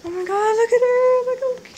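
high, loud squealing shriek; delighted shriek. Real, not acted. Squealing over cute girl on screen.

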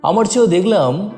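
plain reading voice

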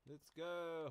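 loud, high rallying call; excited shout. Real, not acted. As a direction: cheer the horse forward. Urging horse on, excited.

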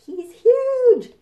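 high, loud amazed cry; amazed exclaim. Pretend. Reader reacting to the dinosaur picture, amazed loud exclaim. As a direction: gasp out how big he is.

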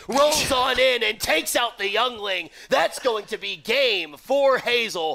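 big, high play-by-play climax, stretching the final words; shouted game call. Acted. Commentator announces game for Hazel, shouted game call. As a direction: belt out the winning call, slowing to the score. Commentator calls the winning blow.

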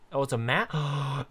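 normal talking voice

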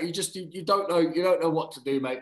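usual speaking voice

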